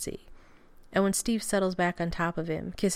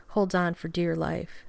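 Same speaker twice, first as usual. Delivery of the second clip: ordinary delivery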